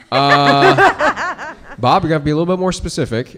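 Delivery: laughing through the words; high, fast, laughing disbelief. Retold or imitated. Laughing reply to Bob.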